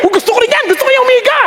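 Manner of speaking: high-pitched excited wishing; eager admiration. Retold or imitated. Preacher replaying his wish to copy another pastor, eager.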